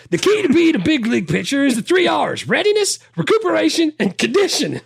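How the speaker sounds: high, loud lecturing pitcher voice; Brickma voice. Retold or imitated. Quoting the whole Brickma speech in a high voice. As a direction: preach it up high like a proud coach. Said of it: "He's like".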